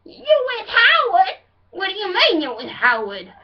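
loud, high, incredulous wail. Acted. Rocker husband challenging his wife, loud incredulous impression. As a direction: question her in outraged rocker voice. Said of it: "my [name] impression".

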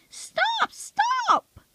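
extreme falsetto stop, stop; very high-pitched yell. A narrator's reading. Bill finally cracking at the pranking diesels, shrieking high. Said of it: "in an even higher pitched voice than anyone would think".

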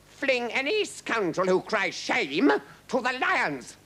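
slow, high, furious command; roaring king. A narrator's reading. King threatening the protesting crowd, roaring. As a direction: roar a threat, drawing it out. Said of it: "roared the king".

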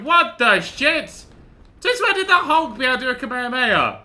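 high, loud disbelief; astonished outburst. Real, not acted. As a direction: yell in amazed protest. Astonished at game move.

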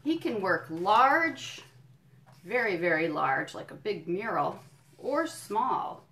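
slow, swelling on large; reading to children. Acted. Educator reading a book to children, making large sound big. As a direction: paint the size with your voice. Reads picture book aloud.

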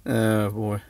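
ordinary talk

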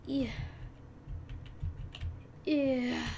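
ordinary talk, nothing marked

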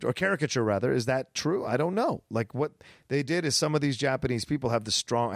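ordinary delivery

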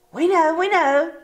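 high, anxious insistence; cried out. Acted. Sisters insisting to the sister, crying out. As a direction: cry it out in a panic. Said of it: "cried the two sisters".

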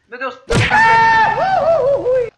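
rising to a loud high shriek; panicked scream. Real, not acted. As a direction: scream in alarm, building up. Caught in shootout. (grito de desespero)

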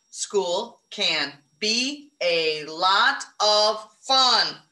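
very slow, word by word; slow counting. Shown as a demonstration. Teacher counting words aloud with the class, slow. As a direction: space out each word to count it. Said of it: "Count 'em".

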